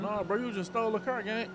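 ordinary talk, nothing marked

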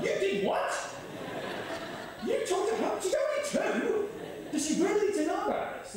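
high-pitched disbelief, climbing with each question; an astonished dad who can't believe it; incredulous. Retold or imitated. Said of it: "And I was like".

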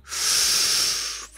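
long, unvoiced sucking breath; soul-sucking inhale. Naruto sucking out Gaara's soul, long inhale. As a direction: draw the soul in with one breath. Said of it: "breathing in, just literally going".

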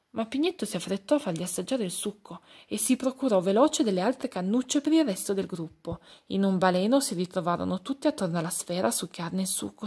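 usual speaking voice

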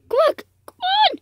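high, loud desperate shout; urgent calling. Real, not acted. Player begging the runaway donkey back, urgent shouting. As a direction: gasp and yell for it to return.

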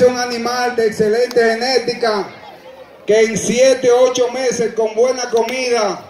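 ordinary delivery

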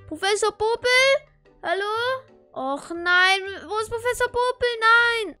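loud, rising panicked calls; panicked calling. Acted. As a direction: cry out in alarm. Calling for missing professor.

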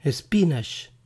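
"Spinach" as es-PEE-nash; wrong quiz option. Shown as a demonstration. Teacher offers a decoy choice, "Spinach" as es-PEE-nash. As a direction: say "Spinach" with e before the s and a final sh. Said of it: "¿Cómo se pronuncia espinaca en inglés?".

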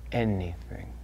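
P=A; quiet. Acted. Quiet answer, then repeated loud.

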